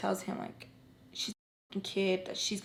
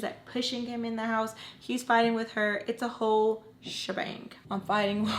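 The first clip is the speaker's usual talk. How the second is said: ordinary delivery, nothing marked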